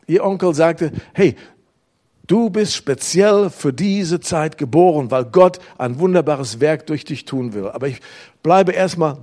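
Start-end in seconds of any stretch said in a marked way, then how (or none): none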